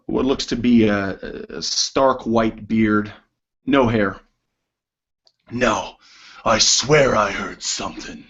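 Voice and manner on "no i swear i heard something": lightly voiced wary dwarf; a hint of a dwarf character; lead dwarf's voice. Dwarf insists he heard something, lightly voiced character. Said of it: "this dwarf has grayish skin".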